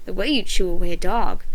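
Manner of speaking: usual speaking voice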